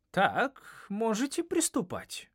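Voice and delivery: impatient teacher calling on them. A narrator's reading. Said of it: "сказала учительница".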